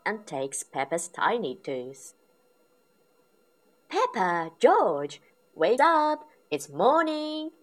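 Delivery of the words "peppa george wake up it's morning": higher, louder cheery morning voice; bright wake-up call. A narrator's reading. Mum wakes her piglets in the morning, bright and higher. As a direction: sing it out brightly to wake them. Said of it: "says Mummy Pig brightly".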